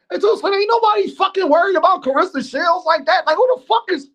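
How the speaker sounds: scoffing impression of Dame brushing off Two Cent; imitating Dame. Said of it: "that's Dame mentality".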